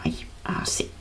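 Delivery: breathed out with no voice; whispered. Shown as a demonstration. Teacher whispering the sound to show it. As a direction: whisper it like a secret. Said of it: "¿Ah?".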